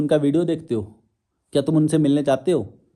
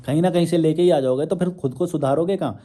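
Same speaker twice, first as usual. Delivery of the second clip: ordinary talk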